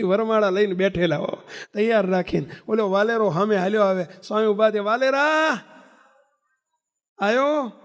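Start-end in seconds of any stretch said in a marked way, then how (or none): 4.8-7.7 higher, fond greeting to a returning disciple; affectionate call. Acted. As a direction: call his name gladly, welcoming him home. Swami greets returning devotee.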